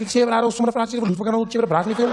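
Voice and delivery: high run of Cape Afrikaans slang; Cape Town guy. Retold or imitated. Guy accosts him at the waterfront, thick Cape accent. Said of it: "He was like".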